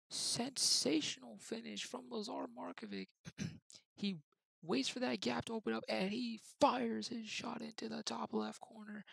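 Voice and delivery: commentator calling the match; live play-by-play. Acted. Gamer calling his own match like a TV commentator, live play-by-play. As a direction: call it like a TV football commentator.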